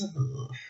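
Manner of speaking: very quiet "ugh" after singing; soft groan of distaste; disgusted groan. Real, not acted. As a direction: let out a faint, revolted groan.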